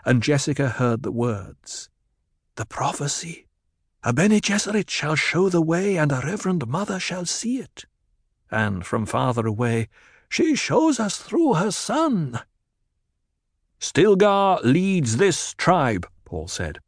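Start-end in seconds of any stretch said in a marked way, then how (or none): 2.5-12.4 high, awestruck voices passing on a prophecy; awed crowd murmur. A narrator's reading. Crowd passes the prophecy along, high and awed. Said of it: "A sighing whisper of awe". 13.8-16.1 high, slow declaration to a crowd; commanding proclamation. A narrator's reading. Leader proclaims his deputy to the tribe, high and commanding.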